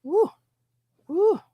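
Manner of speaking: high whoops; excited whoop. Real, not acted.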